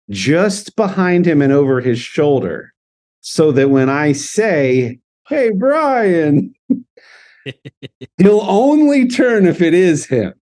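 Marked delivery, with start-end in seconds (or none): 5.3-6.6 drawn-out, high-pitched hail; high call-out. Acted. Calling Brian from just behind him, high hail. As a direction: call lightly to someone over their shoulder, pitched high.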